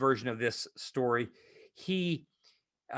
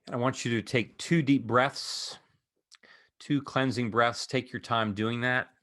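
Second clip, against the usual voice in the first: calm voice guiding eyes-closed imagery; guided meditation; 4 semitones lower than usual. Acted. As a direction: ease them into stillness one instruction at a time.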